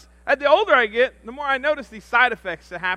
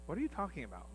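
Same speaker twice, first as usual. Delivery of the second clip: much softer, unbothered mother; calm mom; 4 semitones lower, 17 dB quieter, faster than usual. Retold or imitated. Calm mother answering her panicking son, much softer. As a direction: brush it off flatly. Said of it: "So she goes".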